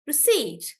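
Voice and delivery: receipt with the last syllable stressed; stress on SEAT. Shown as a demonstration. Teacher showing receipt to students, stress on seat. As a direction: punch the "ceipt" in receipt. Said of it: "we are stressing on the seat".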